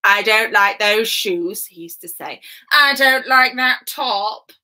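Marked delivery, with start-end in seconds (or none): 0.0-1.6 slow, loud disapproval; mean emperor. Acted. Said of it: "being a bit mean to people". 2.7-4.5 loud, drawn-out disapproval; rude emperor. Acted. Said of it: "How rude".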